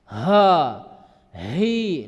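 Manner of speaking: ha, hi forced low and loud from the chest; heavy chest ha. Shown as a demonstration. Teacher demonstrates the wrong chest ha. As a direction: push ha from the chest. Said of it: "itu salah".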